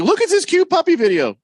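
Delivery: loud, very high squeal; hammed-up excited cooing over a video; gushing puppy lover. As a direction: show it off in a high, loud gush. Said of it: "somebody's like".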